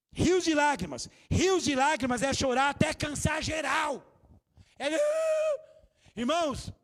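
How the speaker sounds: shouted, slow and heavy; loud preaching. Real, not acted. Pastor proclaiming rivers of tears to the church, shouting. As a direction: proclaim it at full force to the church.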